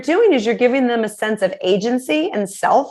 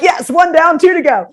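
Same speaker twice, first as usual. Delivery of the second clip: higher and louder little victory cheer; mock cheer; 7 semitones higher, 4 dB louder than usual. Mom cheering her kid's chore choice, upbeat. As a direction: celebrate it like a small win.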